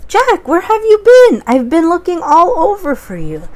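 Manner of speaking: high, loud worried mother; mother calling out, high and loud; worried mom. Acted. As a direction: scold like a relieved mom. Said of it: "his mom said".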